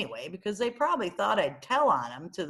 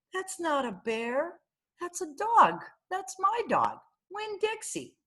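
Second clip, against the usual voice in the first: high, emphatic correction; a girl telling the librarian the bear is her dog; insistent child; 10 semitones higher than usual. Acted. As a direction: set her straight, eager and sure.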